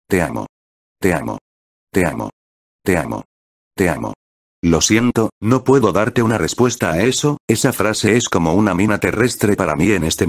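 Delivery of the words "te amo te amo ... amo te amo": low, murmured love from the shadow; a dark, low murmur that clings; witch's murmur. Acted. The witch's shadow murmurs love to Subaru, low and hushed. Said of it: "los susurros de la sombra negra".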